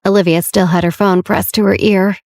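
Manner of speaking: ordinary delivery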